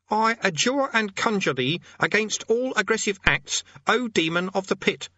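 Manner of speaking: high-pitched, declamatory incantation; self-important conjurer. Acted. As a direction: command a demon in a high voice. Amateur conjurer addresses demon.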